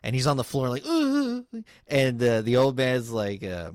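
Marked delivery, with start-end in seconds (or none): 0.8-1.4 mock pained groan. Doctor groaning on the floor.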